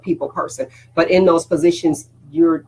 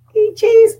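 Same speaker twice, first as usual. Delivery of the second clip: startled; 7 dB louder than usual. Real, not acted. Sudden reaction mid-talk.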